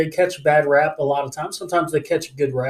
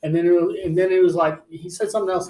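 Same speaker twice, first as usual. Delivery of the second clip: plain reading voice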